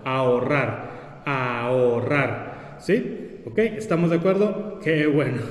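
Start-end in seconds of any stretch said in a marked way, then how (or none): 0.0-1.2 Ahorrar said slowly, a and o apart; slow demo word. Shown as a demonstration. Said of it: "un hiato entre la A y la O". 1.2-2.8 ahorrar even slower, low fall; slow demo word. Shown as a demonstration. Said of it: "un hiato entre la A y la O".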